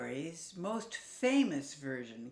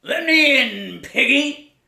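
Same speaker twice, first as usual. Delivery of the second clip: high, loud wolf calling in; a wolf wheedling at the door; loud high sing-song wolf; 8 semitones higher, 17 dB louder than usual. Acted.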